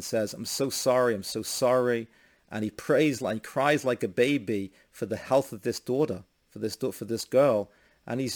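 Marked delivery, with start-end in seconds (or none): none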